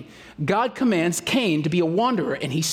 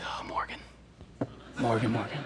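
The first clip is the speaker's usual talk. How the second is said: whispered oh Morgan; 8 semitones lower, 10 dB quieter than usual. Retold or imitated. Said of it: "it's like".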